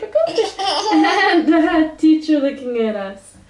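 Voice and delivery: laughing through the words; laughing reading aloud. Real, not acted. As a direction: giggle while reading the ending. Laughing through book ending.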